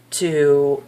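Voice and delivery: usual speaking voice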